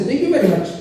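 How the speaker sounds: polite thanks in a mock Indian accent. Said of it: "I started in an Indian accent".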